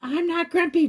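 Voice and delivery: insist, a little sulky. Acted. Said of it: "Jim insisted".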